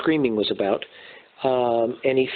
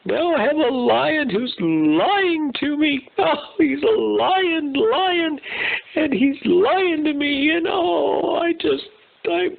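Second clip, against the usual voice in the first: high, slow, whiny sobbing zebra voice; overly dramatic zebra; slower than usual. Acted. Zebra stuck in the mud wailing to himself, hammed-up sobbing. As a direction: wail like a hammed-up crybaby zebra.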